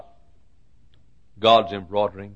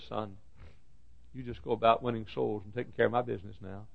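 soft, hushed counsel; gentle divine reassurance; 12 dB quieter, faster than usual. Acted. The Lord looking down to counsel his son, hushed reassurance. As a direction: soothe quietly after the outcry. God's quiet answer.

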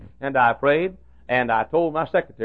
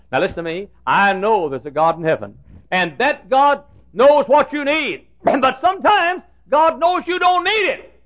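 preaching at full force; 12 semitones higher, 6 dB louder than usual. Acted. Pastor charging his congregation, full preaching force. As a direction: hammer the truth home to the flock.

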